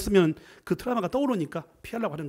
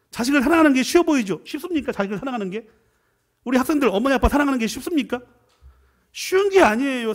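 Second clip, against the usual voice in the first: emphatic challenge; 6 semitones higher, 4 dB louder than usual. Acted. Preacher challenging congregation.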